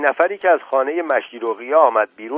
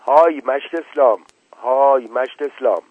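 raised, higher hail; narrator's moderate shout, pitched up; calling out; 4 semitones higher, 5 dB louder than usual. A narrator's reading. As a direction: call across the yard for someone. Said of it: "داد زد".

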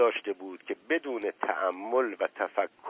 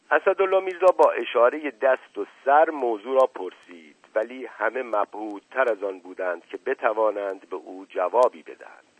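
plain reading voice

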